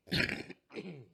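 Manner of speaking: real dry coughing; coughing fit. Real, not acted. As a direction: cough hard and clear throat. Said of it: "pardon me".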